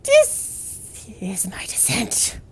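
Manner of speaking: slow eerie whisper. Pretend. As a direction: whisper it slowly, ominous. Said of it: "my descent into madness".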